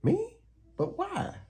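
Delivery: very high startled kitten; Kitty stunned by Unicorn; shocked. Acted. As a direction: jolt in surprise. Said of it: "as shocked as an eel".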